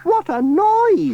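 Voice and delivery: put-on high, loud, slow old lady cry; startled old granny cry. Granny Dryden hearing the blaring radio, high loud cry. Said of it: "cried Granny Dryden".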